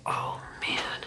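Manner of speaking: a breathless, unvoiced whisper of dread; whispered groan. Asked about forgiving dad, a dreading whisper to herself. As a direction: mutter it under your breath, dreading it.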